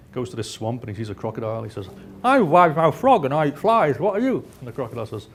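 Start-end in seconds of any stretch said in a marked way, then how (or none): 2.2-4.5 loud, higher cartoon frog voice; big-mouthed frog, broad and loud; wide-mouthed frog. Frog greeting a crocodile in the swamp, loud wide-mouthed voice. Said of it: "this wide-mouthed frog's walking through".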